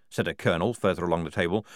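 ordinary delivery, nothing marked

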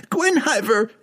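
high-pitched calling out; desperate cry. A narrator's reading. Regis trapped by the wall, desperate high cry. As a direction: cry the name in panic. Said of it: "Regis cried".

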